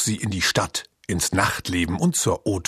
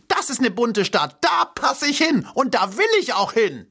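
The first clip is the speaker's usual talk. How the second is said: much higher, crowing rooster voice; 13 semitones higher than usual. A narrator's reading. Rooster crows his case for Berlin. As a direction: crow it out, feathers ruffled. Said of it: "krähte der Hahn".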